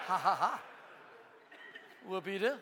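fake, spoken ha-ha with mock cheer; mock laughter. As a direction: deliver a deliberately hollow little celebration. Mocks the smug comeback.

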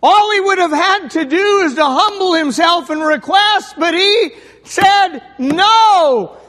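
high, loud, shouting preacher; pulpit-pounding revival preaching; preaching at full force. Acted. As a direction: build each line like a hammer blow. Preacher climax, drawn-out no.

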